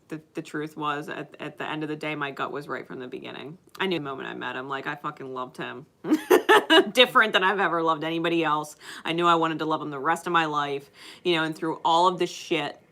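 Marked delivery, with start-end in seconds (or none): 6.0-6.9 short loud laugh, pitched up; real laugh. Real, not acted. Laughing while confessing she loved him. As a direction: let a quick laugh burst out.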